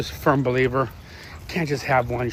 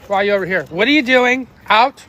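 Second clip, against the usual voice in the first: sharp louder command, pitch up; scolding the dogs; 5 semitones higher, 5 dB louder than usual. Real, not acted. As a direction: bark an order at the dog.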